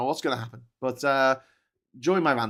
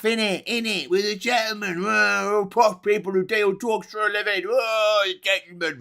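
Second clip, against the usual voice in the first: higher, put-on posh tone; mock posh voice; 8 semitones higher, 4 dB louder than usual. Streamer mocking a posh gangster film in a put-on voice.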